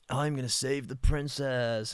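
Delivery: slow mock-prince voice; Disney prince. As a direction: lightly parody a storybook hero. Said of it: "all the Disney princes".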